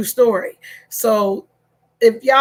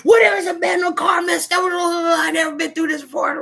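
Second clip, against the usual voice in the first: whiny husband; 6 semitones higher than usual. Retold or imitated. Husband complaining to wife about dent. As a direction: play the flustered husband. Husband's upset reply voiced.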